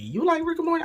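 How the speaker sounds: giddy high-pitched fan question; excited squeal. Retold or imitated. Re-asks the question, much more excited.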